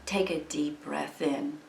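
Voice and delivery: soft, unhurried meditation voice with long pauses; guided meditation. Acted. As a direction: gently guide listeners into breathing and letting go.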